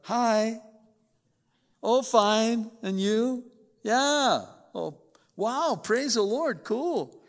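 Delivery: high, loud, sugary phone voice; fake phone cheer. Spouse answering the phone mid-argument, sudden fake sweetness. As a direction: sound all sunshine on the phone.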